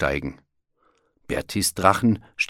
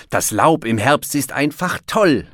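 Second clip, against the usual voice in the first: higher-pitched happy exclamation; enthusiastic call; 7 semitones higher, 4 dB louder than usual. A narrator's reading. Bear praising autumn leaves to his friends, enthusiastic call. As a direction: call it out with delight. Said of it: "rief Berti".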